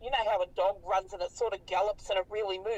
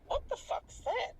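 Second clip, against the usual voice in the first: hushed, high, breathless disbelief; shocked blurt; 13 semitones higher, 5 dB quieter than usual. Retold or imitated. Teen to mum in the car at night, hushed high shock. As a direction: gasp it out, half whispered, in shock.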